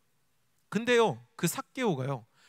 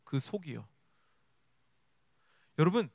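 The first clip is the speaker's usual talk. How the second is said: ordinary talk, nothing marked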